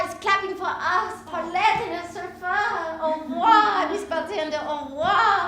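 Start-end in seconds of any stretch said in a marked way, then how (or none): 3.0-5.4 excited goodbye. Retold or imitated. Paulette leaving bar, crowd clapping.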